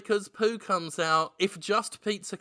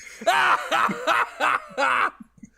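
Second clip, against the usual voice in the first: evil laugh; 8 semitones higher than usual. Acted. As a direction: cackle like a monster. Villain laughs again.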